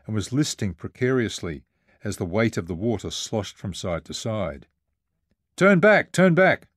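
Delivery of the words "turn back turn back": high, loud holler; raised, high-pitched urgent cry; hollered plea. A narrator's reading. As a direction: holler urgently over the waves. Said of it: "hollered Dana".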